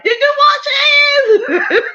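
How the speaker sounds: high, loud, slow question broken by laughter; laughing mock demand. Host mimicking the guest checking on her family, laughing. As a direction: shout it through giggles. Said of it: "you be like".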